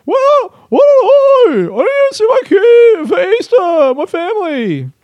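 very high, loud mock wail; defensive outburst. Comedian defending himself for missing family Easter, defensive high-pitched outburst. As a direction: shriek it in indignant, over-the-top self-defence. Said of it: "why I was talking like that. Feeling defensive".

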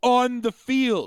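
each word of On the field drawn out slow, high and loud; On the field stressed. Real, not acted. Friend ranting about the voter, stressing On the field. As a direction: spell out On the field slowly as if to an idiot.

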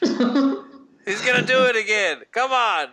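high, laughing holler; cackling orc. Acted. Orc calling others to watch again, gleeful holler. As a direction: call them over, loud and tickled. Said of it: "He's just cackling".

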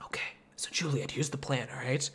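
hushed, quick, plotting whisper; scheming whisper. Retold or imitated. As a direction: whisper it like a secret plan.

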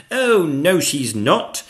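loud, high defiant shout; knight's yell. A narrator's reading. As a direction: yell back at the dragon. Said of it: "yelled Gadabout".

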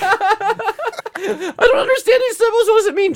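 very high, loud, slow laughing shout; mock confused shout. Guy laughing and shouting fake confusion at the symbols. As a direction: bellow it in fake bafflement.

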